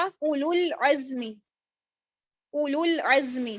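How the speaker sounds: slow اولل عزم, the waw not pronounced; slow Quran recitation. Shown as a demonstration. Teacher reciting the correct اولل عزم slowly for students. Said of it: "میں نے اس کو اولل عزم نہیں پڑھا".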